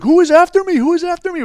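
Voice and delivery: very high, loud panicked mimicry; imitated freak-out. Terrified passenger questioning his captors, mock panic. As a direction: act out a man losing his head. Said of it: "this guy's freaking the fuck out".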